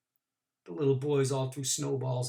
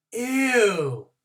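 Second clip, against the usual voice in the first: put-on high, loud girl's ew of disgust; girl holding her nose, high disgusted ew; 7 semitones higher, 6 dB louder than usual. A narrator's reading. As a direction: squeal it like a snooty little girl.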